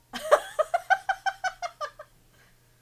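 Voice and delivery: loud squealing laughter; loud high laugh. Real, not acted. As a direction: burst out laughing. Cracks up at the text.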